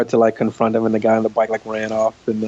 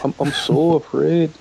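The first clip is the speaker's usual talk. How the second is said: slower, lightly raised Scottish voice; Scottish accent echo; 5 semitones higher than usual. Shown as a demonstration. Co-host copying the Scottish accent demo, milder and slower. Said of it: "Oh, that's nice".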